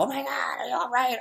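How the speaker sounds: high, alarmed mock concern; mock worried cry. Imagined viewer calling out to her, mock alarmed. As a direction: play a panicked viewer, half in jest. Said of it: "I don't want you to be thinking, like".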